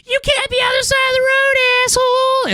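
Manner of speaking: shrill mocking yell at a scooter rider; angry mom driver. Mocking a mom driver yelling at him on the road. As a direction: play a furious mom yelling out her car window. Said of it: "moms in their cars just being like".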